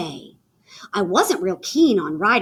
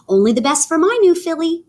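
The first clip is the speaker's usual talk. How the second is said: proud and eager; 7 semitones higher, 5 dB louder, faster than usual. Acted. Girl proud of her filly.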